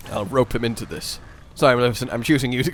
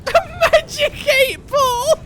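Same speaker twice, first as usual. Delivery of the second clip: high, slow, laughing disbelief; 5 dB louder, much slower than usual. Real, not acted. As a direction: stretch it out in amused disbelief. Incredulous at silly suggestion.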